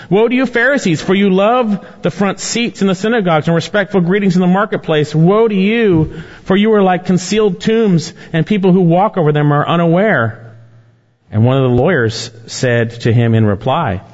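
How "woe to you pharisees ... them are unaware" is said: high-pitched, forceful denunciation; preaching a rebuke. Acted. Preacher reads Jesus rebuking the Pharisees, denouncing. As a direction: proclaim the woes high and insistent.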